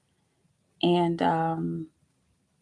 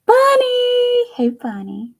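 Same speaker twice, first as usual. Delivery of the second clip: high, loud delighted call of the cat's name; excited call; 11 dB louder, much slower than usual. Real, not acted. Calling out to Bunny, high excited squeal. Greeting the cat on stream.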